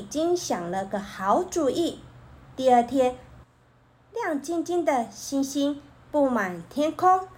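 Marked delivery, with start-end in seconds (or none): none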